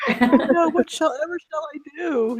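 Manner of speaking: high, hammed-up Southern belle dismay; mock damsel in distress. Friend joining host's act, mock-distressed Southern belle. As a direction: play a helpless belle in mock despair.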